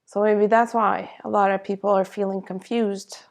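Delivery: ordinary talk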